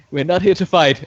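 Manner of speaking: loud shout. Acted. Player in character tells foes they won't fight, loudly shouting. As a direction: shout it so everyone hears. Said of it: "but loudly shout".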